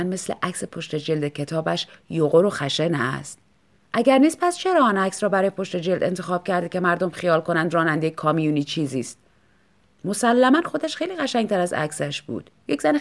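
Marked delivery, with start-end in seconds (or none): none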